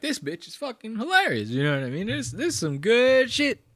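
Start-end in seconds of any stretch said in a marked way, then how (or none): 0.0-1.5 high, softer mock audience voice; put-on voice of a delighted spectator; mock crowd member, pitched high; imitating the crowd. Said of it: "They're like". 2.1-3.6 mock Texas twang, pitched up; Texas crowd accent. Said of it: "You know how they talk down there in Texas".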